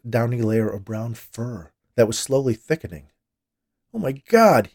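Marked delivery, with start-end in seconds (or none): 3.9-4.7 high, loud shock; alarmed, raised outburst; horrified exclamation. A narrator's reading. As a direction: cry out in horror. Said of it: "he exclaimed".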